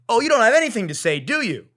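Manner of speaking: sarcastic gloating dig; mocking taunt. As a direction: taunt the silent agents. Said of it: "Just like that".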